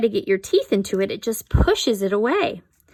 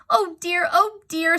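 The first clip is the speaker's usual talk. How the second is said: fretting wife; 9 semitones higher than usual. A narrator's reading. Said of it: "said Missus Gregg".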